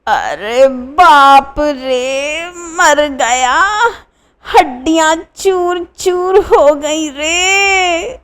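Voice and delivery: slow, high, crying moans; drawn-out tearful groans; groaning. A narrator's reading. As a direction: wail each word out in pain. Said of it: "Bhupen कराहते हुए".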